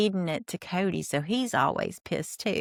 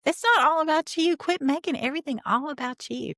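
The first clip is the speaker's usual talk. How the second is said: exasperated outburst; 9 semitones higher than usual. Real, not acted. Podcaster scolding Robin on the screen, exasperated and high. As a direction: tell her off, fed up.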